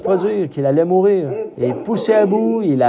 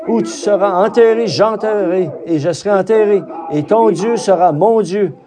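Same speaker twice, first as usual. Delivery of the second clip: impassioned retold cry; 3 semitones higher than usual. Preacher voicing Ruth's vow to Naomi, crying out with conviction. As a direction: cry out each pledge higher, in measured phrases. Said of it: "et elle s'est écriée".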